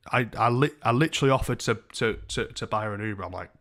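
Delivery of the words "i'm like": normal talking voice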